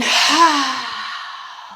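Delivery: long, loud open-mouthed sigh; big sigh. Shown as a demonstration. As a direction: breathe out in a big audible sigh. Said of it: "a lovely big sigh with your mouth open".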